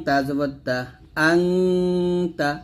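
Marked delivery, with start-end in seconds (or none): 1.3-2.5 "আংতা" with a long steady ng; nasal ghunnah. Shown as a demonstration. Teacher repeating the example for learners, "আংতা" with held nasal. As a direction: linger on the hummed nasal in "আংতা". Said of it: "অনুচ্ছেদের মতো করে উচ্চারণ করতে হবে".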